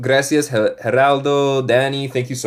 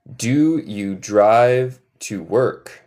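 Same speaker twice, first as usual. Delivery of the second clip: very slow, every word stretched; slow model question; much slower than usual. Shown as a demonstration.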